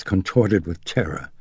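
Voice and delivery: ordinary delivery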